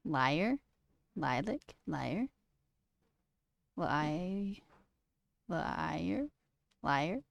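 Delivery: soft, questioning tries at liar and Lilac; quiet rising "lie-er?", as if checking; testing pronunciation. Shown as a demonstration. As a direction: sound out "lie-er" and "lie-lick" softly. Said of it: "How do I say liar?".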